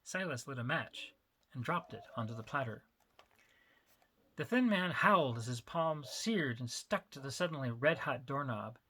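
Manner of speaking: plain reading voice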